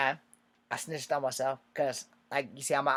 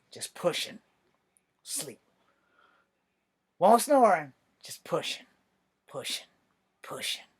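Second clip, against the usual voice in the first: drowsy half-asleep murmur; sleepy whisper; 4 semitones higher, 3 dB louder than usual. Retold or imitated. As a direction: drift off while mumbling. Dozing while doing push-ups.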